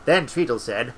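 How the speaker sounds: usual speaking voice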